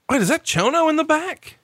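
high, startled question; suddenly notice him in the back; amazed double take; surprised realization. Real, not acted. Spotting Chono in photo.

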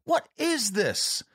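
high-pitched, drawn-out mock outrage; imitated scream. Grandson imitating his grandfather protesting his own condition, high mock screaming. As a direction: stretch it out in a high, appalled put-on voice. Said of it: "he'd scream at us".

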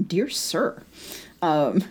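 ordinary delivery

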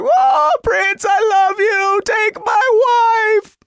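very high, half-sung mock cry; hammed-up falsetto wail, stretched at the end; ecstatic fan wail. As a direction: scream adoration like an overcome fan. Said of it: "And I'm like".

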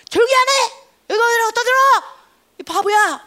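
loud, high, angry scolding; the crowd yelling at the blind man, angry and loud. Acted. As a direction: snap at him to shut up.

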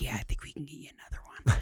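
hushed sweet Southern flight attendant reassuring a nervous flyer; soft whispered flight attendant. Retold or imitated.

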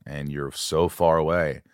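ordinary delivery

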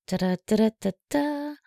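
usual speaking voice

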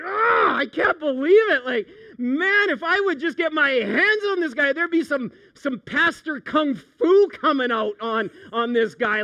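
high, loud, exasperated rant; worked-up pastor venting at the scammer; indignant outburst. Retold or imitated. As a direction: let the fury spill out, half laughing. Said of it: "I was so mad".

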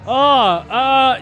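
ordinary talk, nothing marked

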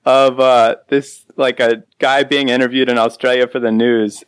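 ordinary talk, nothing marked

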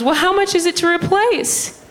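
very high mom voice; high mock-mom question; mom impression. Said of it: "So she goes".

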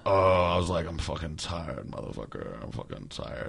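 low, very soft tired grumbling; rambling to self. Retold or imitated. Recalling being alone in the kitchen, rambling. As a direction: grumble slowly under your breath, as if alone. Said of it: "I was, like, rambling".

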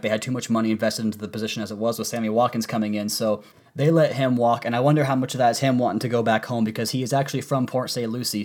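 ordinary talk

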